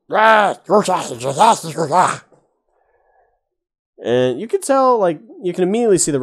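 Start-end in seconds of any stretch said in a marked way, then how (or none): 0.1-2.2 higher, louder goblin cry; goblin yell. Acted. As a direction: shout it like a goblin warning. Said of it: "hears the, the words out".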